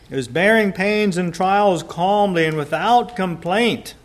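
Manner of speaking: loud, high preaching at full force; rising pulpit fervour. Acted. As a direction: preach it out to the congregation. Preacher reads definition fervently.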